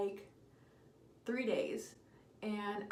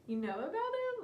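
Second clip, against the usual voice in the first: shrill high squeak of disbelief; mock shock; 10 semitones higher than usual. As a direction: squeak it out as if caught. Retold shock at being caught.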